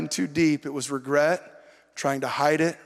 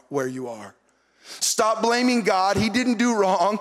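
usual speaking voice

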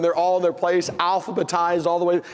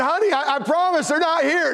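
high, insistent holler; calling back; 10 semitones higher than usual. Retold or imitated. Husband protesting to his wife in another room, hollering. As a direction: insist across the house.

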